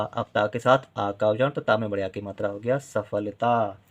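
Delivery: ordinary talk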